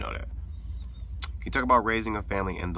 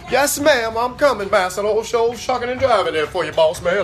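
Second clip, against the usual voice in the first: loud, higher-pitched hammed-up Southern servant; frantic subservient servant; 10 semitones higher, 8 dB louder than usual. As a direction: call out in mock panic, hurrying for the boss. Plays frantic servant calling to master.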